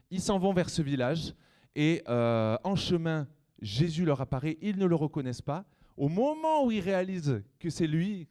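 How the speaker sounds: put-on lively, loud storytelling for kids; reading to a toddler. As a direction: tell it big for the children. Tells Bible story to children.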